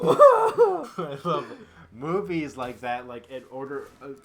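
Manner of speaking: high, loud laughing between words; breathless laughter. Real, not acted. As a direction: laugh so hard you run out of air.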